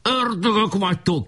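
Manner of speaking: high-pitched outcry; shouting. A narrator's reading. Bombed chief cries at his enemies, high shout. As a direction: cry out high at the attackers. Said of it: "kiáltott".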